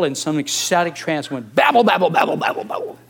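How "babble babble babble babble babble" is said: mocking babble. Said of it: "That's disgusting".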